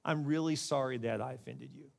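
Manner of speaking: soft, low, earnest apology; sincere apology. Shown as a demonstration. Preacher showing how to skip the if, sincerely sorry. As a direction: say it gently and mean it. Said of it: "and just say".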